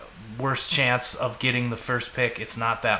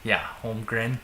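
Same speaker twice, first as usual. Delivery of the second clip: Holmgren with a Minnesota accent, rounded long o; 2 semitones lower than usual. Real, not acted. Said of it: "That makes my Minnesota accent really come out".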